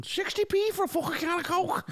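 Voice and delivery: very high, throaty Scouse outrage; exaggerated Scouse accent. Scouse mate shocked by London prices, high mock Scouse accent. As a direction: squawk it as a cartoon Scouser. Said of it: "a Scouse mate down to London".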